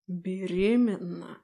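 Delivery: slow, menacing demon hiss; demonic whisper. Acted. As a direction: hiss the word in disgust. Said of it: "прошипел демон".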